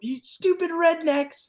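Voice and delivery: high, slow mock-furious insult; going nuts. Timmons chewing out his blood-covered handlers, going nuts. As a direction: imitate the boss's high, dragged-out rant. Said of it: "going nuts".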